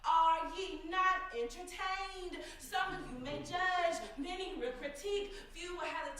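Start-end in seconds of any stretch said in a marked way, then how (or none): 0.0-2.4 slow, sung-out taunt; drawn out challenge. Acted. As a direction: stretch it like a challenge to the crowd. Poem line, drawn out.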